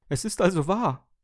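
hoch und laut frohlocken; verzückter Toaster, hohe, kräftige Stimme; seliger Jubel. A narrator's reading. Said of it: "jubilierte er selig". (selig jubilierend, mit gehobener Stimme)

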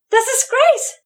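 high-pitched, horrified cry; aghast mother's shriek; horrified scream. A narrator's reading. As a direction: cry out in shock, voice leaping way up. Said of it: "schrie die Mutter entsetzt".

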